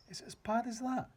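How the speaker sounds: put-on high, alarmed child's voice; a frightened girl asking; girl asking the giant, high and anxious; little girl. A narrator's reading. Sophie's voice.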